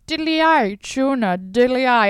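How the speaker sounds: high, loud, lilting stage-Irish parody; hammed-up Irish accent. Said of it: "I'm not gonna be like".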